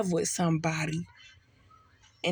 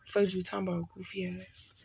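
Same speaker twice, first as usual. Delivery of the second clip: muttered aside; 6 dB quieter than usual. Pretend. Mocking an imagined person's question.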